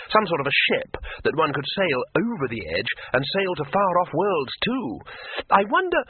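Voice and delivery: dreamily excited, running away with the idea; mounting eager tourist; building excitement. Acted. Two Flower grows excited.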